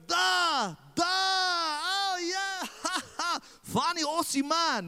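hammed-up babushka laughing at the foreigner; laughing Russian babushka. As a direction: laugh it off like a tickled grandma. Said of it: "She goes".

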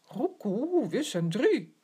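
high, loud cooing bird voice; cooing pigeon. Acted. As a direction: coo it like a pigeon. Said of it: "koort de duif".

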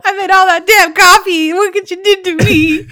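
high, hammed-up wailing complaint; high-pitched mock tirade; mock angry mother. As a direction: play a melodramatic mom losing it. Co-host mimics Alice's tirade.

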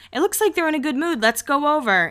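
a hint of perky Steph; voicing Steph. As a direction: lightly play an eager friend. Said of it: "Steph says".

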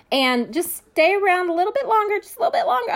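string the audience along in a high mock-sweet voice; imitated live host stalling. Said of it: "one of my friends said".

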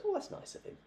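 put-on breathy falsetto of an innocent old lady; naive old wife. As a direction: coo it like a sweet, clueless granny. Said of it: "Ethel goes".

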